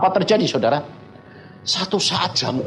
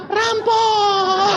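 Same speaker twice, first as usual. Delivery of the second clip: high-pitched drawn-out scream, hammed up; mock-panicked cry for help; terrified scream. Preacher re-enacting his scream about robbers, terrified. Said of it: "saya jadi teriak-teriak".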